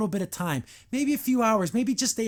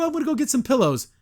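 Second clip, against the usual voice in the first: fast, high, eager; excited; 6 semitones higher, 4 dB louder, faster than usual. Retold or imitated. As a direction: rush it out happily. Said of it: "She's like".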